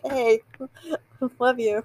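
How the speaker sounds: drawn-out, tossed-off imitation with a laugh; casual. Imitating guy friends throwing out love yous, casual. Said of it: "they just casually throw it out".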